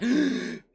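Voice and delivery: a sharp, high gasp for air; breathless gasp. Retold or imitated. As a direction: gasp like you've run out of breath after dancing. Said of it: "and I'm out of breath".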